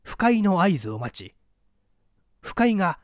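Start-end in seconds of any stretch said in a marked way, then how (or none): none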